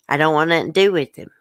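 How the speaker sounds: ordinary talk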